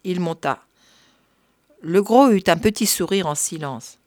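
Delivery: usual speaking voice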